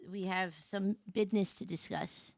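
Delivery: low, soft, offhand delivery; understated announcement. Real, not acted. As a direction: say it quietly, as a casual note. First take, low and casual.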